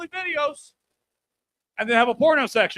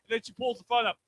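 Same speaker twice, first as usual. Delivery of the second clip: usual speaking voice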